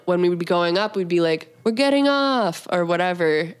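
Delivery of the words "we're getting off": high, slow singsong taunt; a playful kid's call from the chairlift; mock taunting call. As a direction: sing it out to tease the kid behind. Teasing kids calling from chairlift.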